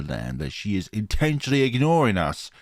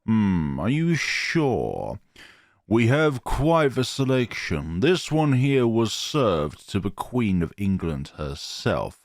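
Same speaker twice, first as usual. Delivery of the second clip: slow, mock-grand posh waiter; posh accent; slower than usual. Waiter act persists after Gidget waves it away, posh accent. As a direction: draw it out in a posh sales patter. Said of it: "in my best posh accent".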